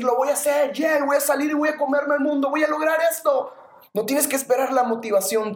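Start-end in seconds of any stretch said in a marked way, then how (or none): 0.0-3.5 pumped-up shout. Retold or imitated. Listener fired up by a podcast, pumped-up shout. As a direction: sell it like you're ready to eat the world. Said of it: "súper motivado para decir".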